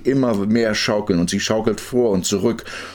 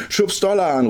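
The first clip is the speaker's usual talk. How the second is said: high, urging cry; excited call; 11 semitones higher than usual. A narrator's reading. Girl on swing wants more speed, calling out. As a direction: urge him on, pitched way up. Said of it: "ruft sie".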